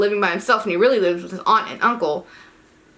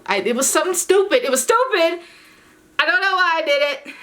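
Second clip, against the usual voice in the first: high, louder self-scolding; embarrassed; 9 semitones higher, 3 dB louder than usual. Real, not acted. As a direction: cringe at yourself out loud.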